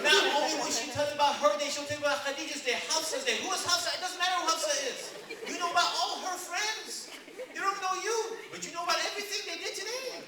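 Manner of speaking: loud, high, breathless rush of gossip; chatty wife. Acted. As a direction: rattle off every detail at the top of your voice. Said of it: "And she does this".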